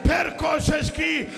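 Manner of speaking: high, drawn-out sermon cry; impassioned lament preaching. Acted. Preacher telling of a child trying to speak before Yazid, high anguished lament. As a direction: stretch each phrase in rising grief.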